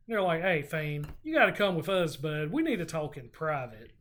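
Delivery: normal talking voice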